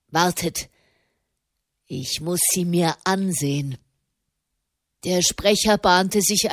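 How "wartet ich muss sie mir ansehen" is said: a hint of a deep, commanding man; deep booming voice. A narrator's reading. Burly leader holding back his men, lightly voiced deep command. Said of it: "dröhnte eine tiefe Stimme".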